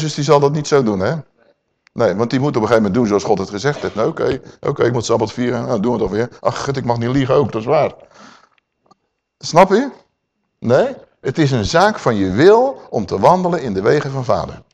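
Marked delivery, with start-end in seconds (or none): none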